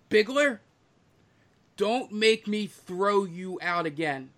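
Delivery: lightly voiced tavern keeper's warning; sharp high name, then a slow warning; warning bartender. Bartender threatening to throw out Bigler, mildly scolding.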